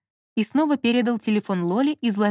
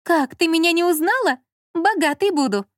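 high, playful woman's voice; giggly chirp; 8 semitones higher, 3 dB louder than usual. A narrator's reading. Lola teasing Zhanna for not recognising her, giggly. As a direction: chirp in playful mock surprise. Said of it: "Лола хихикнула".

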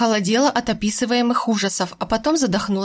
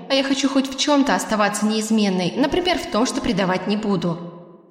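plain reading voice

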